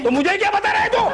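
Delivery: high-pitched irritated comeback; exasperated. Acted. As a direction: toss it back, thoroughly exasperated. Exasperated boss snaps at young customer.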